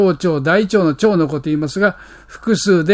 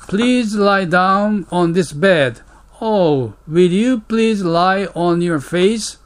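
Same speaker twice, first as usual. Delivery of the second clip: slow model reading; much slower than usual. Shown as a demonstration. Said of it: "English model dialogue read slowly".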